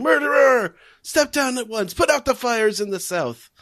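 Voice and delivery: high protest shouts; yelling. Retold or imitated. Crowd yelling at the king, high and loud. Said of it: "they're yelling".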